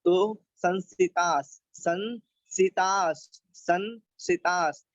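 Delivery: संसितास slow, with a half स at the end; teacher drilling संसितास; slow model word. Shown as a demonstration. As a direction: end संसितास on a bare स with no vowel. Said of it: "आधा सा बोलना है".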